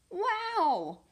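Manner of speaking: high, loud gasp of wonder; bright burst of amazement; amazed wow. Acted. Kelp amazed as the unicorns teach him.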